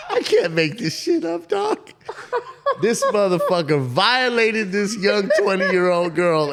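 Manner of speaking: cracking up, high and loud; helpless laughter breaking into the words; laughing. Real, not acted. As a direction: barely get it out through real laughter. Cracking up at his own story.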